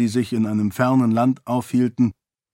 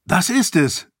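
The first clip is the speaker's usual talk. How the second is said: hoch und lauter herausgerufen; aufgeregter Ausruf; 8 semitones higher, 3 dB louder than usual. Acted. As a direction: Entdeckerfreude laut ausrufen. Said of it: "rief Raoden". (Raoden ruft aufgeregt über seinen Fund, hoch und laut)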